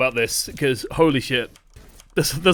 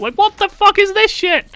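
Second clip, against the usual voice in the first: much higher, loud incredulous shout; angry outburst; 6 dB louder than usual. Real, not acted. Coach losing it over teammates shooting each other, angry and much higher. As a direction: blow up in disbelief. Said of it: "I'm getting angry now".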